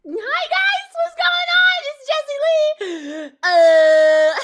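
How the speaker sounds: high, loud, bright-eyed intro voice; overly cheerful vlogger. Acted. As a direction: greet them like a bubbly host.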